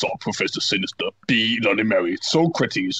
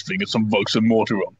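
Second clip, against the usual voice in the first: normal talking voice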